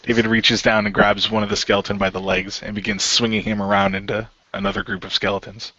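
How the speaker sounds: usual speaking voice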